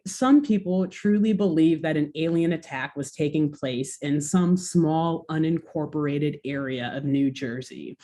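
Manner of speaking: normal talking voice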